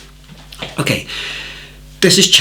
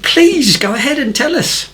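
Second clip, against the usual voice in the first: bright, much higher, eagerly inviting; eager high invitation; 13 semitones higher than usual. Retold or imitated. Teacher surprised by a student standing, inviting him high. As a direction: welcome him up with surprised delight. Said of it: "And I said".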